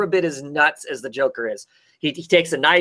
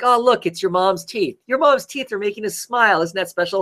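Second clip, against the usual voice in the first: higher-pitched sing-song gloating; mock-sweet taunt; 5 semitones higher than usual. Joker shows the boss his mother's teeth, mock-sweet taunting. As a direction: tease him with playful false delight.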